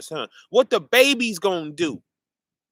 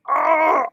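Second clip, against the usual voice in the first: angry cry; 8 semitones higher, 5 dB louder than usual. Acted. Acting out being furious.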